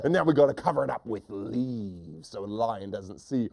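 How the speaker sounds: lightly voiced scheming hunter; mean hunter. Hunter planning to hide the net, mildly mean voice. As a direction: explain the plan in a hunter's voice. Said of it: "The first hunter says".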